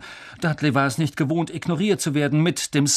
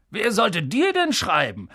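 higher, scornful question; sneering; 6 semitones higher than usual. Acted. Uncle scoffing at the boy's claim, sneering. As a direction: jeer at the very idea. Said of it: "höhnte Onkel Vernon".

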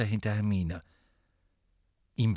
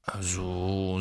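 drawn-out doubtful hesitation; stall, not at all persuaded; mildly sceptical; unconvinced; 4 semitones lower, 3 dB quieter than usual. A narrator's reading. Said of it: "er klang keineswegs überzeugt".

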